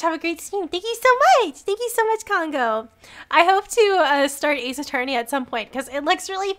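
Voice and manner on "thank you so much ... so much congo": high, squealed gratitude; excited thanks. Real, not acted. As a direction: thank them in a high, delighted rush. Thanks viewer for gift.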